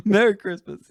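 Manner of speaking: high, loud singsong holiday greeting; mocking cheerful greeting. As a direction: chirp it like a cheery clerk. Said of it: "Oh, you said".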